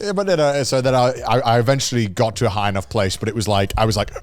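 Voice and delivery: usual speaking voice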